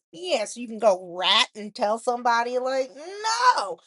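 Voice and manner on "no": emphatic no. Real, not acted. Rejecting the idea outright.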